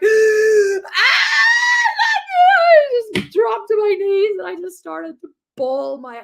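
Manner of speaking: loud, high, sobbing cry; breaking down crying. Retold or imitated. Overwhelmed mum collapsing in the hall, sobbing. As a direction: wail it out as you collapse. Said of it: "I was just like".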